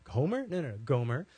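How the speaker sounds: baffled, checking the name; incredulous mishearing. Retold or imitated.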